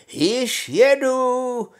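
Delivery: high, loud, long-drawn holler; calling out. A narrator's reading. Honza rides the goat down the road, hollering. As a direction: holler it out, stretching the vowels. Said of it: "zvolal Honza".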